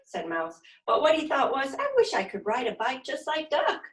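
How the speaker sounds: put-on lively, expressive read-aloud for little kids; animated children's story narration; storytime reading. A narrator's reading. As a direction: read it like a silly picture book to a class. Reading picture book to children.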